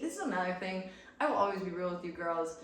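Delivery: usual speaking voice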